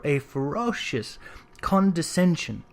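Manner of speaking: usual speaking voice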